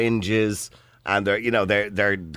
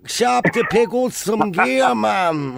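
slow, drawn-out, much higher strung-out voice; stoner Dublin voice; 11 semitones higher, slower than usual. Acted. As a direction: play a stoned Dublin lad heading to the shop. Host mimics stoner Dublin caller.

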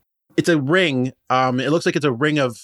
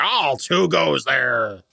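a hint of a halfling guard booming, slow; big booming voice; 4 semitones higher, much slower than usual. Lightly voiced halfling guard booming at travelers. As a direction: puff up and boom the challenge slowly. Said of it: "in a big booming voice".

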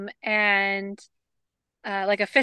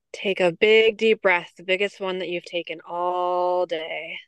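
guided grounding. Acted. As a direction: ease listeners into their breath. Leads a grounding exercise.

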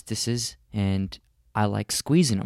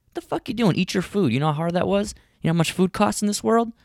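high, hammed-up scolding father; angry dad; 9 semitones higher, faster than usual. Father scolding son for not eating, mock angry. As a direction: scold the kid like an old-school dad. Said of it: "He slapped me".

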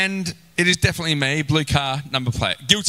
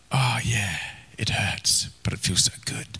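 rueful hushed reply; 7 semitones lower, 5 dB quieter than usual. Retold or imitated. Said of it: "And you're like".

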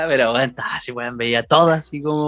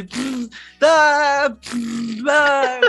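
imitated burbles and yelps; 11 semitones higher, much slower than usual. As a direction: alternate burbling with sudden high cries. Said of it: "a gritar así y a hacer como".

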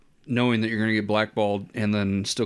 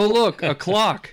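mock British tourist; 8 semitones higher, 3 dB louder, slower than usual. Mock Brit marvels at clock.

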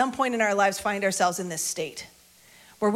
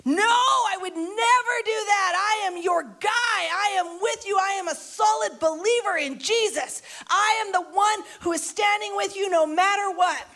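high-pitched, insistent loyalty; vehement protest; 9 semitones higher than usual. Acted. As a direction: protest it with total conviction. Peter protesting Jesus' prediction.

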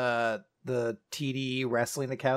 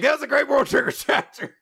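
gushing fan; 11 semitones higher, 4 dB louder than usual. Retold or imitated. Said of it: "People will be like".

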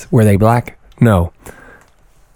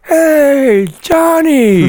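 calling out; much slower than usual. Retold or imitated. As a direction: shout a cheerful hello. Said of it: "he's like".

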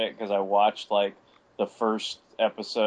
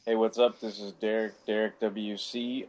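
normal talking voice

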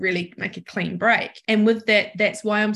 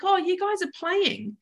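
cheery to dogs; 9 semitones higher than usual. Retold or imitated. Retelling her thought to the dogs.

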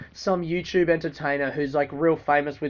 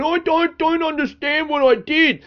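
much higher, whiny mock innocence; mocking the YouTuber; 11 semitones higher, 4 dB louder than usual. Mocking the YouTuber's apology, squeaky whiny mock innocence. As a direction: play dumb in a squeaky mock voice.

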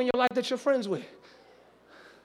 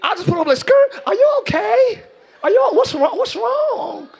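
worried partner; 10 semitones higher, 5 dB louder than usual. Retold or imitated. Partner asking angry person.

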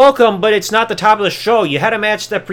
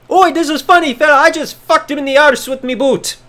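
mock gloating Irish accent; 5 semitones higher than usual. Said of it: "Sheamus gloating".

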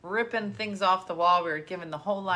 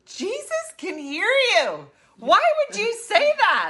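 scandalized; 14 semitones higher, 9 dB louder, slower than usual. Retold or imitated. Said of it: "I go".

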